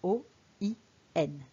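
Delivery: "O I N" letter by letter with long pauses; spelled out. Shown as a demonstration. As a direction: spell "O I N" as three separate letter names. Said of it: "ce sont les lettres O".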